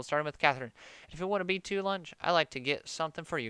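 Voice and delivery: normal talking voice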